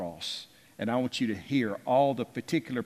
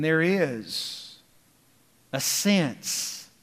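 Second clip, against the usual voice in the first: "sense" set apart after a pause, drawn out; slow down and land firmly on "sense"; weighty, deliberate emphasis on "sense"; stress on SENSE; 4 semitones higher, much slower than usual. Shown as a demonstration. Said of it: "I want to emphasize the word sense".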